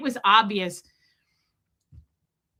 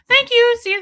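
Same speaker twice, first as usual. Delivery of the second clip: very high, loud singsong thanks; hammed-up sweet goodbye in a high voice; mock-cheery farewell; 13 semitones higher, 10 dB louder than usual. Embarrassed customer fleeing after an outburst, mock-cheery.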